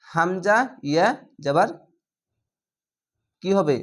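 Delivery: ordinary talk, nothing marked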